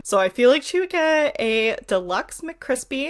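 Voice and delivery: ordinary delivery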